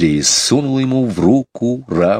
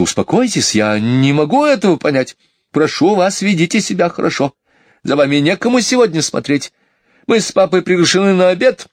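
high, hurried pleading; flustered mother; 10 semitones higher than usual. Acted. Mother hurries children before going out, high and flustered. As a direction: rattle off arrangements in an exasperated high voice.

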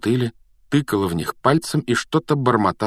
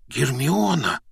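astonished; 6 semitones higher, slower than usual. A narrator's reading. Harry exclaims as Hermione hugs him, astonished. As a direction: say the name in amazed surprise. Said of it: "изумлённо воскликнул Гарри".

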